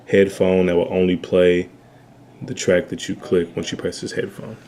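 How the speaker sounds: ordinary talk, nothing marked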